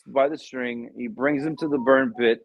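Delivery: ordinary delivery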